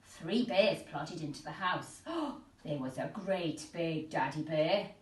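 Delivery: ordinary delivery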